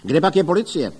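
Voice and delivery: indignant shouted question; calling out. A narrator's reading. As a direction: call it over the commotion. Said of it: "volal do toho Ostrohradský".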